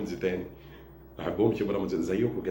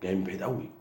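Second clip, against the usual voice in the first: normal talking voice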